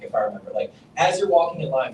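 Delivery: normal talking voice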